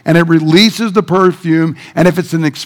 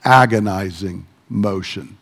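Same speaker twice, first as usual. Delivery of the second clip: soft, lower, dragged out; slow and agonized; 8 semitones lower, 6 dB quieter, slower than usual. Shown as a demonstration. Preacher slows down to show the woman's agonizing act, soft and drawn out. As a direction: let the words sink into slow motion. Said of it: "the whole thing goes into".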